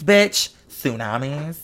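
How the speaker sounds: usual speaking voice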